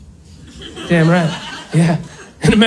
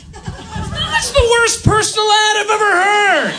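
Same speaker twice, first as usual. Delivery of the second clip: much higher, laughing outburst; incredulous shout; 11 semitones higher than usual. Real, not acted. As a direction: blurt it in disbelief. Comic punchline outburst.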